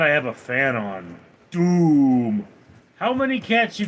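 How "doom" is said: dramatic doom voice. Acted. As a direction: announce it like a movie trailer. Sound alert again.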